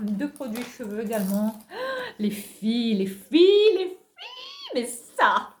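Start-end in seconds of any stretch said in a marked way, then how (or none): 1.6-2.1 sharp high gasp of delight; excited gasp. Real, not acted. Spotting a long-sought product, delighted gasp. 2.2-5.5 rising, much louder and higher calls of les filles; excited. Real, not acted. Showing viewers a long-awaited find, thrilled shout.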